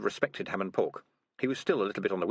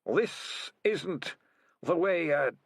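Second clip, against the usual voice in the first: put-on mumbling old rat; 7 semitones higher, much slower than usual. A narrator's reading. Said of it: "he mumbled".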